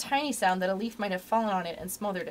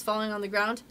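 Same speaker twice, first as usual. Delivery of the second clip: ordinary delivery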